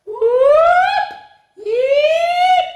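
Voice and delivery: very high whooping squeals; silly sounds. A narrator's reading. Reader forced into silly sounds, piercing high whoops. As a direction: shoot up into a long squeal. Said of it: "make silly sounds like".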